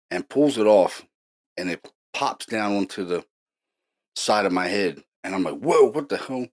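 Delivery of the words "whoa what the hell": higher, mildly startled exclamation; retold startled reaction. Telling how the headphone got pulled off, mildly startled. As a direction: re-enact a jolt of surprise.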